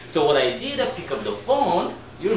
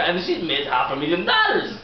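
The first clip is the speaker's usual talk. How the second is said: usual speaking voice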